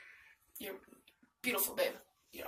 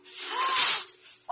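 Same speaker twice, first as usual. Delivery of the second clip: gasp; 14 semitones higher than usual. Real, not acted. Opening a gift, joyful gasp.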